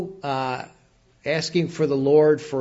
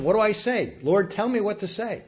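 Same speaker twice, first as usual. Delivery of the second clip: higher-pitched acted plea; asking for direction; 6 semitones higher than usual. Joshua asking the Lord for direction, acted plea. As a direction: plead for guidance in a raised pitch. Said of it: "He's asking for direction".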